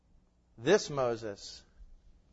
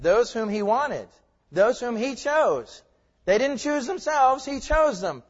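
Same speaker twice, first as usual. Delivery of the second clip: louder, higher stress on "he wanted" and "he chose"; emphatic repetition; 8 semitones higher, 7 dB louder, slower than usual. Real, not acted. Preacher driving home "he chose" to his congregation, emphatic. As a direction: hammer "he chose" with raised voice. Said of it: "see, I emphasize that".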